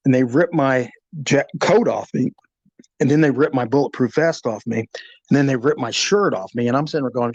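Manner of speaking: usual speaking voice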